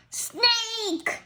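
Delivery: high startled yell; alarmed cry. Acted. Seeing a snake, high alarmed cry.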